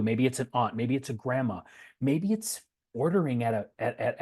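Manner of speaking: ordinary talk, nothing marked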